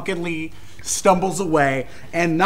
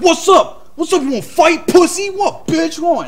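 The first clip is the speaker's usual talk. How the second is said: aggressive bar taunt; 9 semitones higher than usual. Retold or imitated. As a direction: puff up and pick a fight. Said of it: "everybody's just like".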